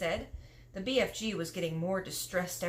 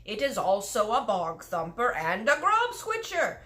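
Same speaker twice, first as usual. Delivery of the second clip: rising much higher and louder, exasperated giant; exasperated cry; 8 semitones higher, 6 dB louder than usual. Acted. Giant correcting Sophie, exasperated high cry. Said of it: "cried the exasperated B F G".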